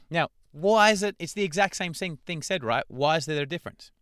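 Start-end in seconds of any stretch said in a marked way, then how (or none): none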